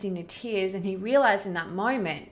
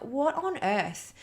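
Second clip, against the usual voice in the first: exasperated disbelief; 7 semitones higher, slower than usual. Retold or imitated. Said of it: "I was just like".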